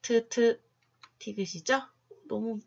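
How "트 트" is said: 트 as a short aspirated T sound, twice; short, separated 트 beats; letter sound demo. Shown as a demonstration. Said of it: "알파벳 T는".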